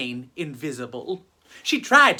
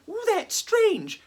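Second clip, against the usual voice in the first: put-on much higher, slow puzzled girl voice; a young ghost puzzling at her own body; high, slow, wondering girl; surprised ghost girl; 13 semitones higher than usual. Ghost girl Elizabeth's thought.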